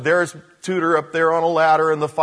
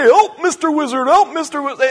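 loud, high cartoon cry; hammed-up panicked call for rescue; mock scream for help; 9 semitones higher, 7 dB louder than usual. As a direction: yell high like a cartoon turtle in danger. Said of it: "he screams out".

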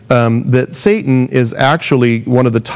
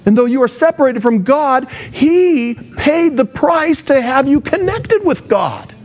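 eager gospel plea; 9 semitones higher than usual. Acted. Voicing the gospel's answer.

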